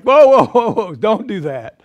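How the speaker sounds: loud, high alarmed "whoa, whoa" that trails off; alarmed shout. Retold or imitated. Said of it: "They were like".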